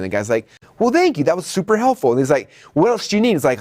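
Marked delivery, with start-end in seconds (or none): 0.8-2.1 grateful customer. Acted. 2.7-3.3 eager salesperson. Acted. Salesperson upselling.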